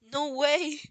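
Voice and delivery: loud, high exclamation; stunned disbelief. A narrator's reading. Izuku reacting in shock to All Might's secret. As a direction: blurt it out, floored.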